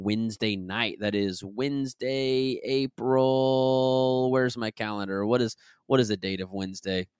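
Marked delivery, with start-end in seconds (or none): none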